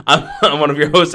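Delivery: laughing. Real, not acted. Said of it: "Don't laugh".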